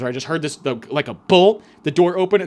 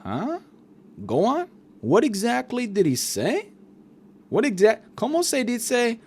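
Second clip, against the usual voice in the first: high, questioning put-on voice; hammed-up rising interrogation; Tony Soprano/Mexican parody; 9 semitones higher, slower than usual. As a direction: play a probing mob dad with Spanish mixed in. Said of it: "Tony was like".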